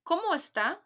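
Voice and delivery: "¿Cómo está?" with a rising question tune; repeated model for the class; model for repeating. Shown as a demonstration. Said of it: "Repeat after me".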